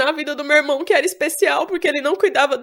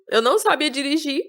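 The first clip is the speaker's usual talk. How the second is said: ordinary talk, nothing marked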